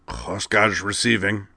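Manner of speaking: slow gravelly Klingon voice. Acted. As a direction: rasp it out like a gruff officer. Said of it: "replied a gravelly voice".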